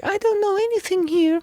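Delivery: high, soft, playing lost and clueless; confused tourist voice. Shown as a demonstration. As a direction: act confused to charm someone at a bar. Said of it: "Whatever voice that is to you".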